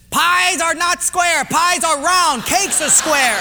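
high, loud, slow certainty; emphatic correction. Acted. Portuguese guy answers the math question, loudly sure of himself. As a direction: set them straight, slowly and loudly.

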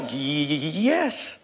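put-on low, stammering old man; shaking elderly man. Old shop owner to job seeker, shaky stammer. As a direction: stutter haltingly on the first sounds. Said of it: "this man is kind of shaking".

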